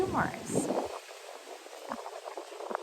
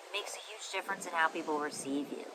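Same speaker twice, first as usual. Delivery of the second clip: ordinary delivery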